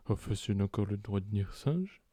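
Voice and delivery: low, slow, soft politician impression; Nicolas Sarkozy. Host quoting a politician on TV, low slow imitation. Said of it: "Nicolas Sarkozy qui dit, euh, dans Quotidien".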